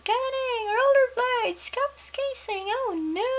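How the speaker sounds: high panicked wail; panicked father. Acted. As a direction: wail each word in rising alarm.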